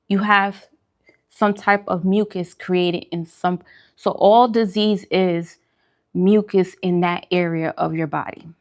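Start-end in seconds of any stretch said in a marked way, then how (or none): none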